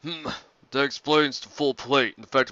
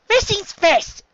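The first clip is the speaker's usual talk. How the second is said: much higher, squeaky small doctor; high little Chopper; 7 dB louder, much slower than usual. Acted. Chopper answering Sanji about Luffy, high squeaky voice. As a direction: squeak it like a tiny reindeer.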